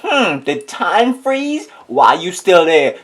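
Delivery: high, very slow puzzled drawl; slow puzzled wonder. Retold or imitated. Seeing her still outside, slow puzzled wonder. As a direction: stretch it out, baffled. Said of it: "So I was like".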